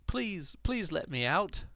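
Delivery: high, slow pleading little voice; pleading creature voice. Retold or imitated. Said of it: "he asked Raudoak".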